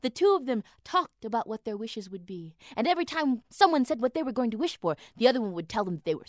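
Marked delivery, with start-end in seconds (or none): none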